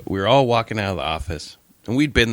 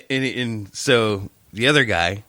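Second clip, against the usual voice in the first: ordinary delivery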